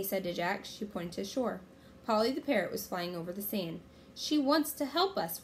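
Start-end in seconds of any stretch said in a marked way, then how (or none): none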